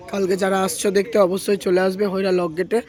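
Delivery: usual speaking voice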